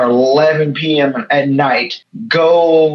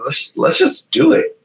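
eager urging. Retold or imitated. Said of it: "we're like".

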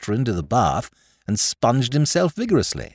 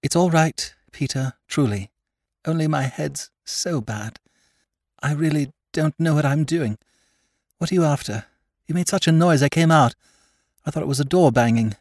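higher, tense sister voice trying to sound calm; strained young woman; 5 semitones higher than usual. Acted. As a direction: hold the panic under a calmer tone. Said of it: "She went on more naturally".

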